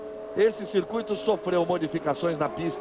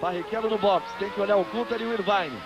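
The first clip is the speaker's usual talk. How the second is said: brisk live race call; motorsport commentator; 3 semitones higher than usual. Real, not acted. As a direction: call the pit stops live, upbeat.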